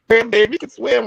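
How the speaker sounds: grandma half-choked with pride; a touch of teary grandma. Retold or imitated. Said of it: "had tears in her eyes".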